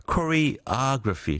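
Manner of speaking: choreography slowly, with the weight on OG, cho-re-OG-ra-phy; stress on OG. Shown as a demonstration. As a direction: stretch it out and hit the OG of choreography. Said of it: "the accent is on the, 어, O".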